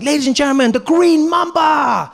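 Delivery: booming high announcer shout; Deng Basan. Retold or imitated. Host introducing the next snake, hammed-up announcer shout. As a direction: announce it big, stretching the final words. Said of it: "Deng Basan is like".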